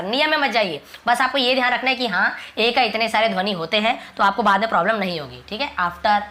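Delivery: ordinary talk, nothing marked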